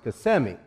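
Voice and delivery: KISSIMMEE as kuh-SIM-ee, stress on the middle; local Kissimmee pronunciation. Acted. Friend correcting the town's name, local pronunciation of Kissimmee. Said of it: "it's actually pronounced Kissimmee".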